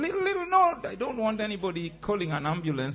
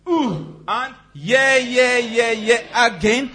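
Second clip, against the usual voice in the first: high loud shout; 8 semitones higher, 8 dB louder, much slower than usual. Shown as a demonstration. Exercise release shout.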